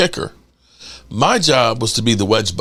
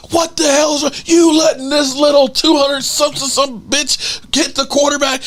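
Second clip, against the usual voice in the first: coach going off; 12 semitones higher than usual. Retold or imitated. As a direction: bellow like a furious line coach. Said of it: "the offensive line coach going off".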